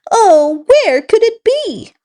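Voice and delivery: high, anxious wondering; a monkey upset about her lost banana; worried monkey cry. Acted. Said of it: "on this very sad day".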